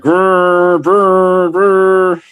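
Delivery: high buzzing brr like a bike horn; imitated horn noise. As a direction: buzz it out like a cheap scooter horn. Drunk riders honking scooter horns.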